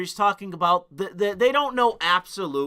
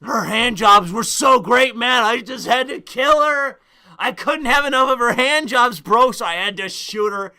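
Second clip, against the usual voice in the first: higher, louder dude voice, hammed-up; mock shooter confession; 6 semitones higher, 7 dB louder than usual. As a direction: parody the killer as a lovesick bro. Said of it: "And it's like".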